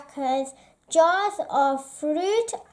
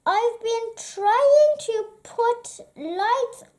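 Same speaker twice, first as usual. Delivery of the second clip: higher, hesitant little voice; timid; 7 semitones higher than usual. Acted. Mole apologising to grumpy Badger, timid higher voice. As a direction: apologise nervously, small and unsure. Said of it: "he said timidly".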